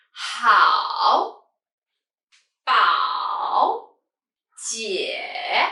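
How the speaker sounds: HAO, BAO, JIE drawn out, dipping then rising; third tone. Shown as a demonstration. Teacher gives third-tone examples, HAO, BAO, JIE dipping then rising. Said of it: "practice this third".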